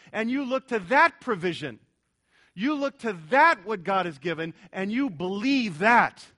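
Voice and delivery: loud, emphatic pulpit delivery; rising preacher's fervor; preaching at full force. Acted. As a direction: hammer the point home to the congregation. Preacher driving point home.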